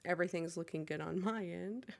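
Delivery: normal talking voice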